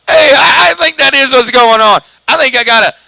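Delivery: talks through a high burst of laughter; laughing at himself on the radio. Real, not acted. Chuckling radio operator, laughing at his own mic habit.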